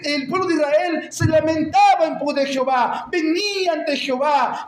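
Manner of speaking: impassioned, insistent exhortation; full-force preaching. Real, not acted. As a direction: press the congregation with rhetorical force. Preaches after telling a story.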